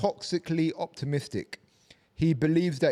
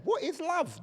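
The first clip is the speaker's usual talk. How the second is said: parrot voice. Retold or imitated. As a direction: squeak it like a curious parrot. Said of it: "if your parrot starts saying".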